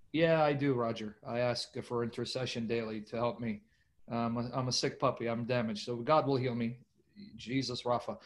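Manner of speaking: low, soft, sincere admission; humble confession. Real, not acted. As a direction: drop down low and confide humbly. Sincere admission, asking for prayer.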